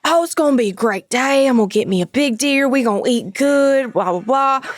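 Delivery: eager Louisiana good-old-boy imitation; Louisiana stepdad. Stepdad in full hunting gear, eager Louisiana accent. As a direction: relish it in a broad Louisiana accent. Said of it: "he's from Louisiana".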